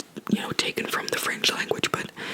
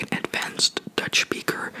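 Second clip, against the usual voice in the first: ordinary talk, nothing marked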